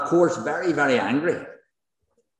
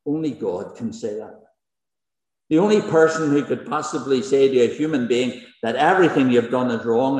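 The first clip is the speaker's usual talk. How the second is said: ordinary talk, nothing marked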